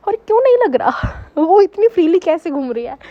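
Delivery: mock the friends' shock; incredulous friends. Friends baffled that the scaredy-cat is fearless, incredulous.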